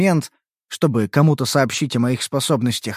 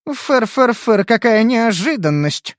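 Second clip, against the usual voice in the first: slow, mocking drawl; 7 semitones higher, slower than usual. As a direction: stretch it out sarcastically. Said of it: "протянула Шия".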